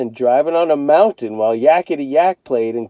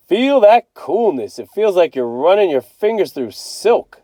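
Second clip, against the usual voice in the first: savoring dad; 6 semitones higher than usual. A narrator's reading.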